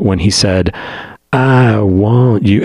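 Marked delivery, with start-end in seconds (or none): 1.3-2.6 drawn out. Retold or imitated. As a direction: drag out "I want you" like a longing crooner. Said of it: "the way he draws it out".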